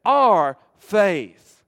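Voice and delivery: full-force pulpit reading; booming scripture reading. Acted. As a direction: proclaim the verse to the whole church. Preacher reads scripture aloud.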